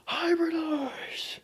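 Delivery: high slow drawn-out shout; long high battle cry; transformation cry. Acted.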